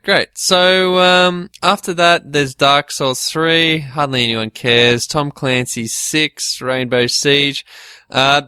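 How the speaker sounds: ordinary delivery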